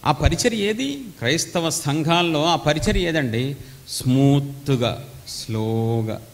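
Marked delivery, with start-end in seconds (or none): 3.9-6.2 mock smooth and slow. Shown as a demonstration. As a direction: drawl it out gently, mocking. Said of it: "నువ్వు అంత స్లోగా చెప్పావు".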